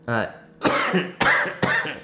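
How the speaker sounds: coughing while speaking; choked-up, struggling to speak; choking. Real, not acted. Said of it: "I'm choking now".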